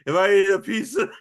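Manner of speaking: mock Ron voice. Retelling Ron scene, acted quote.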